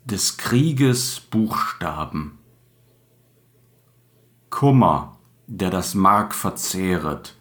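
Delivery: slow, solemn declamation with long pauses; poem recitation. Acted. Podcast host recites an anti-war acrostic to listeners, slow declamation. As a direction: declaim it slowly, each opening noun punched loud.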